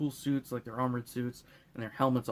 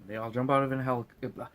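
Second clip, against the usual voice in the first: normal talking voice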